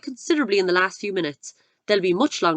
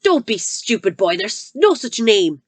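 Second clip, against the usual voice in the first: scornful headmistress; 3 semitones higher, 7 dB louder than usual. Acted. Headmistress scoffing.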